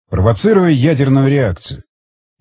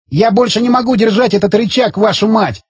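higher, strained yell at the end of his strength; 7 semitones higher than usual. A narrator's reading. Zagorsky to everyone, can't hold the lever, strained yell. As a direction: bellow it, arms giving out. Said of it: "заорал Загорский".

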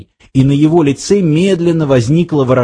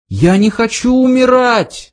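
high-pitched panicky cry; 9 semitones higher than usual. A narrator's reading. Arthur protesting as he is dragged off, high cry. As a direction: cry out in a high voice, no extra volume. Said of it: "закричал Артур".

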